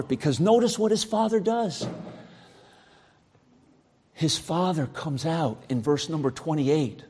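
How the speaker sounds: ordinary talk, nothing marked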